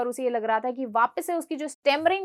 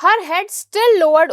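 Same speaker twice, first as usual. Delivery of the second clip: slow, clear English read-aloud for students; slow story reading; 4 semitones higher, 9 dB louder, much slower than usual. Shown as a demonstration. Teacher reading the chapter slowly to her class.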